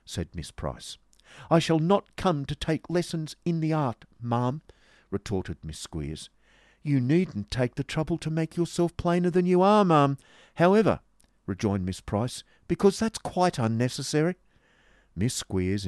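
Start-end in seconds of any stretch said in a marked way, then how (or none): 1.5-4.6 high-pitched, offended hauteur; huffy mock courtesy; haughty retort. Acted quarrel, haughty retort. 6.8-11.0 high-pitched spiteful civility; huffy, cutting politeness; catty rejoinder. Acted. Acted quarrel, insulting rejoinder. 12.7-14.3 high-pitched cutting remark; huffy put-down; catty rejoinder. Acted. Acted quarrel, insulting rejoinder.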